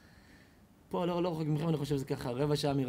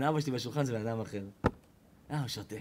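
ordinary talk

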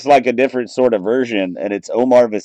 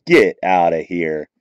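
low, stunned get outta here; awed, low get outta here; disbelief; 7 semitones lower than usual. Real, not acted. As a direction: drop the voice in amazed disbelief. Reacting to the big pull.

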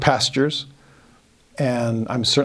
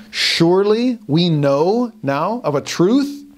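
slow, higher-pitched accusation, acted out; confident affirming; 5 semitones higher, slower than usual. Accusers close in on Peter with evidence, confidently affirming. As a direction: affirm it slowly, each phrase certain and lifted. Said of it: "people are confidently ass-, affirming".

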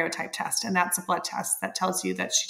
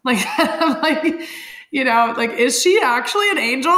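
higher, laughing through the question; laughing wonder; 5 semitones higher, 6 dB louder than usual. Real, not acted. Mother laughing that the nurse was named Angel, giggling. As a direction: giggle at the coincidence.

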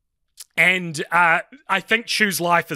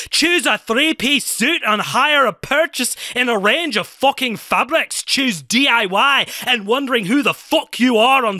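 hammed-up Scottish accent, higher and louder throughout; 7 semitones higher, 3 dB louder than usual. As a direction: rattle off the monologue in a put-on Scottish accent. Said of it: "read it as fast as I can in a Scottish accent".

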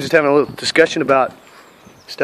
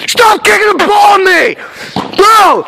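high, loud angry shout; yelling; 4 dB louder, slower than usual. Real, not acted. Vlogger yelling at friends kicking the ball at him, loud. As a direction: yell across at them to stop.

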